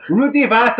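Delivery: high, hammed-up Albanian shout; put-on Albanian accent. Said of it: "I can't put this on".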